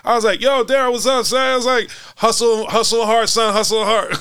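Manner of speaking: high, hyped-up calling out to a coach; excited hype greeting. Retold or imitated. Teller hyping up coach Daryl at courtside, high excited call. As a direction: shout him out like an old friend.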